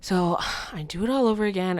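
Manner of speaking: ordinary talk, nothing marked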